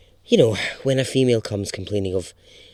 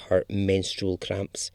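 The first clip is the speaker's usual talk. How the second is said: plain reading voice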